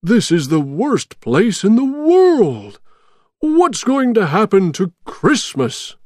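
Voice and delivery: high-pitched, dismayed character voice; dramatic storybook dismay; distressed Santa. A narrator's reading. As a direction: lament your fate as captive Santa. Santa despairs as a captive.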